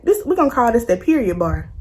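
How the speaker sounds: usual speaking voice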